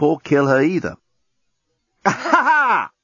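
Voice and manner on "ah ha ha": high-pitched broken yelp; yell of pain. A narrator's reading. Injured journalist bends over body, startling yell. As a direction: yell out in sudden staccato bursts. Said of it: "The journalist gave a yell".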